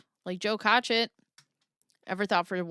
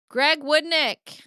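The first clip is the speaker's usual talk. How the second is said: slow, loud, higher name call; dramatic announcement; 6 semitones higher, 5 dB louder, much slower than usual. Pretend. Reading the case emails, dramatic slow name announcement.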